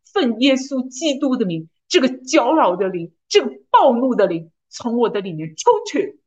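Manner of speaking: emphatic, measured prayer of command; commanding declaration. Retold or imitated. Commanding spirits to leave her, emphatic declaration. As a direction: proclaim it with firm authority. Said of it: "然后我就宣告".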